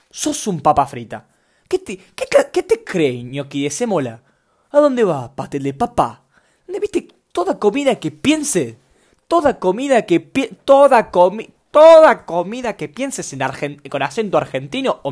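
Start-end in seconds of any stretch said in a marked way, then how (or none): 0.1-1.3 mock insult. Shown as a demonstration. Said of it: "se puede decir un insulto". 1.7-6.2 mock furious insult. Shown as a demonstration. Host acting out food-name insults, mock furious shouting. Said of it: "suenan como un insulto". 6.7-13.9 high, loud and slow mock rant; mock outraged shout. Host acting out outrage about food insults, shouting.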